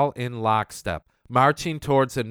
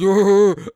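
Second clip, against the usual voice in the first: loud scornful chuckle; mocking laugh; 8 semitones higher, 9 dB louder than usual. Real, not acted. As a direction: laugh at him. Laughs at Morrison nickname.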